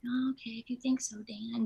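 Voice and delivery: a lightly voiced meek, agreeable woman; soft mock meekness, giving in; meek pushover voice. Actress pretending to meekly agree with Dan, soft and lightly voiced. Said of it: "maybe I should be more like".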